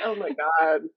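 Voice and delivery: low, laughing disbelief; low laughing exclamation; laughing oh my God. Real, not acted.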